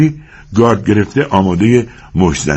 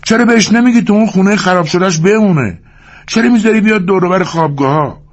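higher complaining cowhand voice; a grumbling worker, pitched up; Carson. Acted. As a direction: raise the voice into a griping ranch hand. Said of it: "کارسون گفت".

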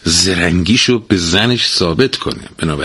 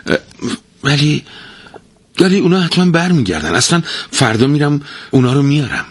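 higher, fast nervous excuses; scared; 8 semitones higher, faster than usual. Acted. Husband fearfully reassures angry wife, high and hurried. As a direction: stammer out excuses in a fright. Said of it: "ترسید و گفت".

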